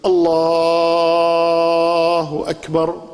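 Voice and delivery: الله with a long stretched lam; drawn-out lam. Shown as a demonstration. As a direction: lean hard on the doubled lam of الله. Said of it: "غلط".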